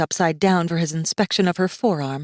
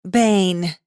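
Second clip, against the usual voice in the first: louder, lightly voiced young woman; girl rephrasing; 2 semitones higher, 4 dB louder, slower than usual. Girl finding a word Masaaki knows, lightly voiced. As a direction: try another word, patiently.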